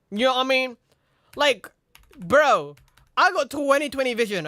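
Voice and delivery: normal talking voice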